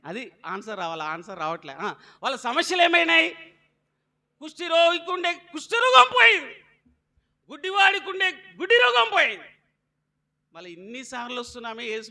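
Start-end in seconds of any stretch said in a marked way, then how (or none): 2.2-9.5 raised, ringing sermon voice; preaching. Acted. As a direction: call it out to the congregation. Preacher calling out to congregation.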